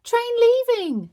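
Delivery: very high loud sing-song call; train conductor call. Acted. Bingo driving the toy train, conductor call. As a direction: announce it like a conductor. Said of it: "said Bingo".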